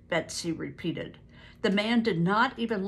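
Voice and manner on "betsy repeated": ordinary delivery, nothing marked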